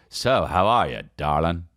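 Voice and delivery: put-on low old-movie leading man; lightly voiced low fifties actor. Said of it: "there's a whole way of talking".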